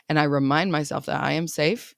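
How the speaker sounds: ordinary talk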